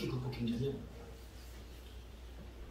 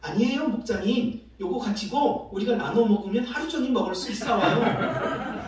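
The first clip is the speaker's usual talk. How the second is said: higher, louder, slowed-down imitation of a child; earnest little child; 6 semitones higher, 5 dB louder than usual. Girl telling pastor one snack is plenty, earnest child. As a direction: reassure the pastor like an eager kid. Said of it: "수연이는 참 양심적이에요".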